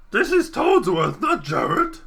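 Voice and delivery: high, slow silly creature voice; a goofy alien-like Toadsworth; Jar Jar style Toadsworth. Acted. Toadsworth corrects Marky in a goofy Jar Jar voice. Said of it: "sounds like Jar Jar Binks".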